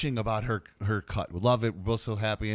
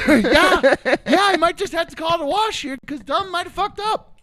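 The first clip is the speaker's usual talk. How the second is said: laughing; 14 semitones higher, 9 dB louder than usual. Real, not acted. Laughing, high self-mockery.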